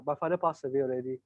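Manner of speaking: quietly deflate the pitch; subdued reply. Acted. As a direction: Fiona telling the salesman her father died, subdued. Fiona answers sadly.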